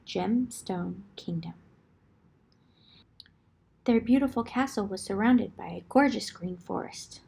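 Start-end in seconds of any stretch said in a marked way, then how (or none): 0.1-1.5 whispered storybook line; hushed storyteller whisper. A narrator's reading. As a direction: whisper it like a secret.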